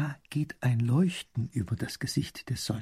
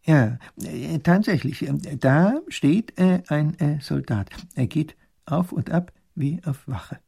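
hesitant professor, higher voice, full of ähs; fussy, halting minister; doddering professor; 4 semitones higher, 4 dB louder, slower than usual. Acted. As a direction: stammer out an observation, halting and drawn out.

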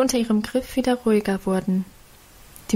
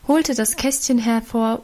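usual speaking voice

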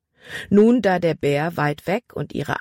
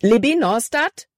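higher, urgent rising call; panicked; 7 semitones higher, 4 dB louder than usual. A narrator's reading. Boy calling the crying girl, mildly panicked. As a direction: call her name with a touch of panic. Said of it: "Calebs Stimme war panikerfüllt".

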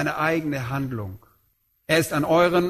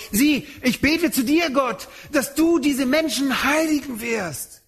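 praying Paul; 9 semitones higher than usual. Acted. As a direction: lift it up as Paul's solemn prayer. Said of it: "Und deshalb sagt Paulus".

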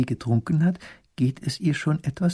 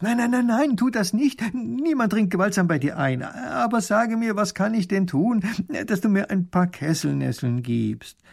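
high, alarmed cry turning to pleading; horrified; 9 semitones higher than usual. A narrator's reading. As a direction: beg him in dismay, pitched high. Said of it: "ruft ganz entsetzt".

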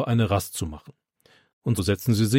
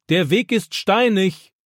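calling warning; 8 semitones higher, slower than usual. A narrator's reading. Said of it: "ruft Opa ihm nach".

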